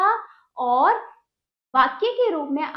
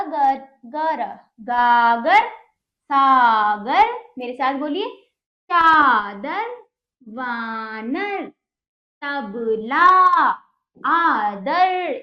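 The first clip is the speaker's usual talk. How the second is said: carefully spaced word-by-word reading, louder than her chat; dictation voice; 9 dB louder, much slower than usual. Acted. Teacher to children, modelling each word for repetition. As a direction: read each word for the class to echo.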